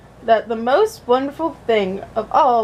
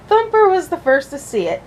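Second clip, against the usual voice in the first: ordinary delivery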